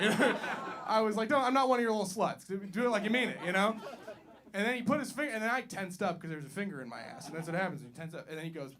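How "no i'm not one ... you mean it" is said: mock demand. Retold demand to the doctor.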